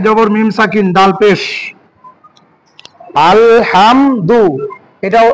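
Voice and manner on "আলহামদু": আলহামদু said very slowly, broken into আল, হাম, দু; আলহামদু in slow, detached syllables; syllables separated. Shown as a demonstration. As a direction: stretch each syllable of আলহামদু on its own. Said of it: "মুক্ত".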